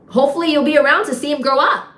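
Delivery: loud, high, pointed jab; pointed retort. Retold or imitated. Bartender answers cousin praising her son, pointed retort.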